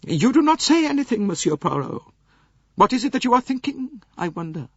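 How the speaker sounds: lofty aged princess voice; old princess. Acted. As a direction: voice the elderly princess, cool and proud. Princess voice held.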